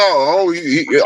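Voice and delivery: ordinary talk, nothing marked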